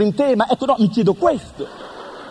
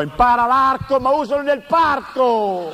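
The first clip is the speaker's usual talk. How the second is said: mock shout; 5 semitones higher than usual. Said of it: "e gridò".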